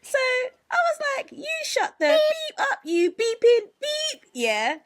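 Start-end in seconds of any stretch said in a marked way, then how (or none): 1.3-4.2 high, slow shout from the chest; shouted with extra vim. Retold or imitated. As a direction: hurl it back with everything. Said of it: "With extra vim".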